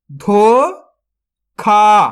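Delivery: धोखा drawn out very slowly, syllable by syllable; sounded out slowly. Shown as a demonstration. Teacher sounds out a word for young readers, very slowly. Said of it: "तो मिलके बन जाएगा".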